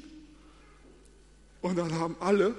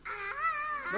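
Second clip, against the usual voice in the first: very high, thin mock shriek "Ah"; high scream; 4 dB quieter than usual. Shown as a demonstration. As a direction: shriek like a frightened sailor. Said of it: "schreien laut".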